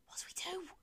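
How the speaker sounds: tense whisper rising in pitch; panicked whisper. Acted. Hiding, panicked.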